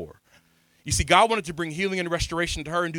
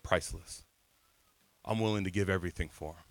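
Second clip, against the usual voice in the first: tender voice of God; 8 semitones lower, 8 dB quieter than usual. Acted. Voices God's tender words.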